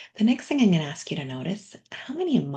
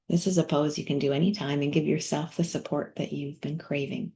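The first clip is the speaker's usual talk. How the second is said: soft whispered cue to rest; whispered guidance; 3 semitones lower, 5 dB quieter than usual. Acted. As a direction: breathe the words out gently, like a calming coach.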